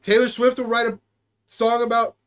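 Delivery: normal talking voice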